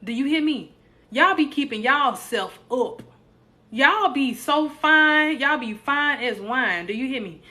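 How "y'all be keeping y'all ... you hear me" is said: usual speaking voice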